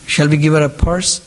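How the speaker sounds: ordinary talk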